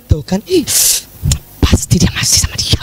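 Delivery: soft, breathy suspicious aside; suspicious whisper. Retold or imitated. A jealous wife muttering that he is still with her, hushed whisper.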